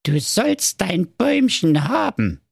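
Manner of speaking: high, cunning dwarf voice; sly dwarf. Acted. Wicked dwarf promises Marco the tree, slyly. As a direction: lure him in slyly. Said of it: "sagte der böse Zauberzwerg listig".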